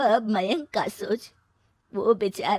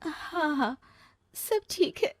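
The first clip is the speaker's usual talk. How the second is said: higher, shaky forced reassurance; holding back tears; 8 semitones higher, 3 dB quieter, slower than usual. Acted. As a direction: pretend all is fine while about to cry. She hides tears, then cries. (आँसू रोकते हुए)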